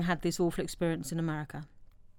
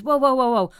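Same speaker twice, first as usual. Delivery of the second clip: mock shout; 4 semitones higher, 5 dB louder than usual. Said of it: "one of them says".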